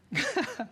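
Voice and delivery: short high-pitched laugh. Real, not acted. Preacher joking with the congregation, short amused laugh. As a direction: let out a quick, high chuckle.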